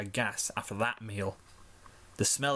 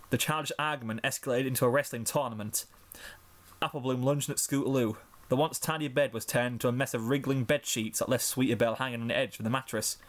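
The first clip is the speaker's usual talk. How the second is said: plain reading voice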